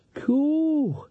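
a very high, drawn-out marvelling cool; high impressed exclamation; amazed cool. A narrator's reading. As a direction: marvel at the frozen guard. Nanny amazed at frozen crowd.

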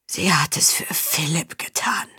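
slow, breathless whisper; dying man whispering the truth. Acted. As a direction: let the confession fade to a whisper. Dying count's last confession.

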